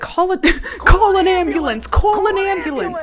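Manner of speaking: high, loud, laughing call for help; mock panicked yelling. Troupe panicking over Paul, laughing mock yell. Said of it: "They're like".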